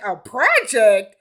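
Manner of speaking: loud, slow incredulous echo; mock indignation. Girlfriend repeating boyfriend's insult, mock indignation. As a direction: throw the word back, drawn out. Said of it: "So then she was like".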